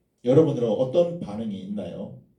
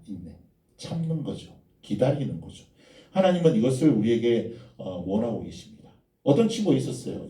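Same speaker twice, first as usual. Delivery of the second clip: ordinary delivery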